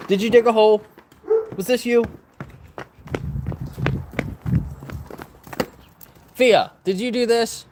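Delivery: high-pitched questioning of a pet; mock interrogation. Real, not acted. Owner asking dog who dug the hole, mock interrogation.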